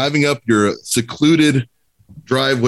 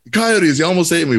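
panicked Tommy; 7 semitones higher than usual. Retold or imitated. Panicked friend quoted in story.

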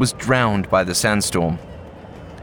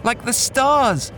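put-on high boy's voice, excited; high young boy explaining in a rush; eager boy. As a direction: tell it like a kid who just cracked it. Boy character voice held.

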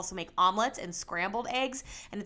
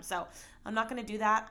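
ordinary talk

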